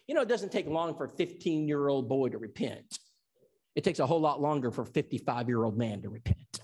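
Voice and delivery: normal talking voice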